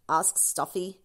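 usual speaking voice

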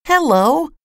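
tiny, very high-pitched call; high little voice, questioning. A narrator's reading. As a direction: squeak it up high like someone very small. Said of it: "A little voice".

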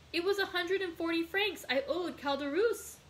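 high-pitched, alarmed protest; crying out. A narrator's reading. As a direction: exclaim it in dismay. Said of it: "cried Dantès".

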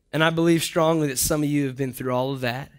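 normal talking voice

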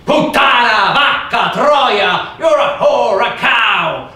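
loud, high spat-out slurs; screamed insults. Retold or imitated. Villagers shout at the wife: loud contemptuous yells. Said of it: "they would scream".

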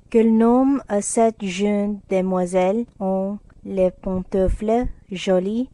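put-on good-natured French phrase in French accent. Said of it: "said Laurie good-naturedly".

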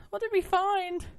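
loud high alarmed cry; yelling while running away. Real, not acted. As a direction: shout it in alarm. Fleeing monsters at night.

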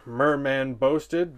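usual speaking voice

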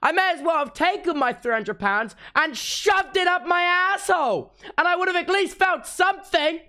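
high, loud exasperated ranting; frustrated tirade at useless tech; angry rant. Real, not acted. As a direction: vent the fury at the gadget. Ranting about expensive tech failing.

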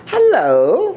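high, loud sing-song hello; calling out. Acted. Lazy bird calls out to the passing elephant, high and loud. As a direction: call it up high and loud across the trees. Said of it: "called the lazy bird".

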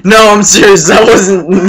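high, loud and delighted; high excited exclaiming; amused outburst. Real, not acted. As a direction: burst out tickled by your own joke. Amused at his own bit.

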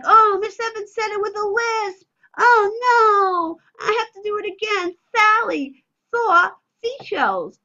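high, loud, silly mock dismay; silly lisp play. Shown as a demonstration. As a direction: ham up a silly mistake for a child. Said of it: "So inflection, intonation, silliness".

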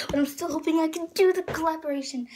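quick, high retake with pitch falling at the end; excited. Real, not acted. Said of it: "I'm so excited".

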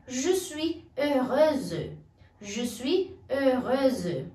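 "je suis heureuse" slowly, rounded eu; careful teacher model; slow model phrase. Shown as a demonstration. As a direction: stretch out "heureuse". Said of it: "uma mulher".

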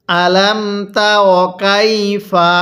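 slow, linked "আলাম তার কাইফা"; joined recitation. Shown as a demonstration. Teacher has learners join words, slow linked "আলাম তার কাইফা". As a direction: run "আলাম তার কাইফা" together with long syllables. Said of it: "আমার সাথে মিলিয়ে পড়ুন".

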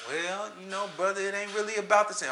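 mock evasive pastor. Imitating evasive pastor's answer.

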